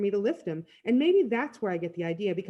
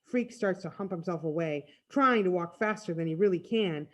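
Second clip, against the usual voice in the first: ordinary delivery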